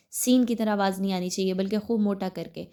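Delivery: ordinary talk, nothing marked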